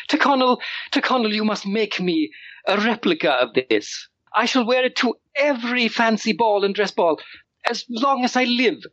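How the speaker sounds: put-on high, breathless boy giving orders; excited child lord. Young lord calls his butler, high and eager. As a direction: demand it like a thrilled little aristocrat.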